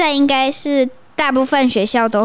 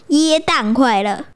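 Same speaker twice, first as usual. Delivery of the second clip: ordinary delivery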